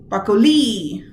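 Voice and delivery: "broccoli" with the stress thrown onto LEE; stress on last syllable. Shown as a demonstration. Teacher testing where stress goes, "broccoli" stressed on final LI. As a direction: punch the end, brocco-LEE. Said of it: "where does the stress go?".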